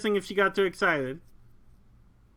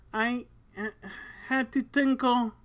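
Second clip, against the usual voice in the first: weak voice; 6 semitones higher than usual. Acted. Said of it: "called out in a weak voice".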